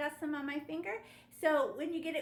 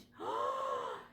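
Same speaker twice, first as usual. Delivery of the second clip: breathy gasp. Pretend. Teacher marvels at blending.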